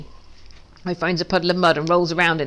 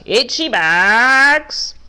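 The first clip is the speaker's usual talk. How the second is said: slow, loud, drawn-out bleating sheep voice; 6 semitones higher, 7 dB louder, much slower than usual. Acted. Duck calls to sheep in their own language, slow bleat. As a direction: stretch it out like a lazy sheep. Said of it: "in one big voice".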